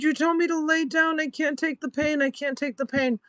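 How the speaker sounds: acted distress, voice held very high; retold pained protest. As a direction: re-enact protesting through pain.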